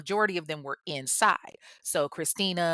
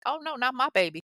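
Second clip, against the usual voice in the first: higher, mock-shocked mama; a mother reacting in dismay, pitched up; lightly voiced horrified mama, higher; 9 semitones higher, faster than usual. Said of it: "she was like".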